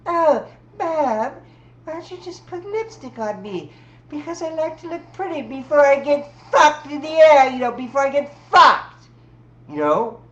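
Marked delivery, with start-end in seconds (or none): 0.1-9.1 put-on high, whiny child voice, pleading with Mom; whiny little kid. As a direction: beg like a spoiled kid. Kid talking to mom again.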